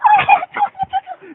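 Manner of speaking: mock the ladies with a squealy laugh; girly giggle. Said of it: "the senoritas are like".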